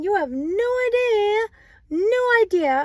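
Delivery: high, loud, fed-up protest; exasperated inner thought. Retold or imitated. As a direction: let the frustration burst out in your head. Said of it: "you in your head, you're thinking".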